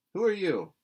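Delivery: loud, high curt demand. A narrator's reading. Miss Barry confronting the girl, demanding. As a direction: snap the question. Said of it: "demanded Miss Josephine Barry without ceremony".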